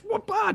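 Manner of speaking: high-pitched "whoop-ah" effect; quick high imitation of a whack; playful swing noise. Co-host miming a cast swung at a ball, playful noise.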